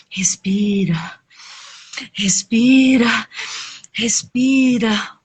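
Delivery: very slow, drawn-out breathing coaching; soothing guide with long pauses; calming. Acted. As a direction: stretch the word out to soothe someone panicking. Character calms the anxious macaw.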